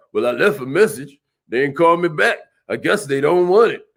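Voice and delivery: low, deflated mumble of a quitter; discouraged average salesperson. Retold or imitated. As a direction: sound like you've already given up. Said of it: "you're like, uh".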